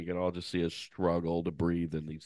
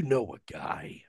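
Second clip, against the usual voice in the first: hushed whisper aside; 3 dB quieter, slower than usual. Real, not acted. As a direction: whisper it like a secret. Aside about Dershowitz.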